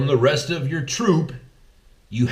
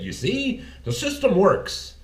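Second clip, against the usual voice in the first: higher, smug and self-satisfied; smug mock voice; 9 semitones higher than usual. Podcaster imagines Hillary voters smugly praising the system. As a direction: act out a smug winner. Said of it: "would you have sat there and said".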